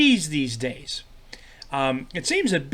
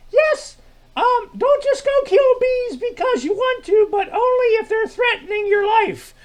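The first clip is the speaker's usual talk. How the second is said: high-pitched puppet sidekick; 5 dB louder than usual. Acted. [name] answers Milt's greeting in a very high sidekick voice.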